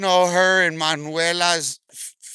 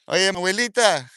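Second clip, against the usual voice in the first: raised, sing-out call of a name; mock calling out; 4 semitones higher than usual. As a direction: call out as if hailing someone. Calls absent rival once more.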